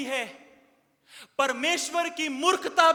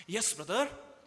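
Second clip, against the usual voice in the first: ordinary talk, nothing marked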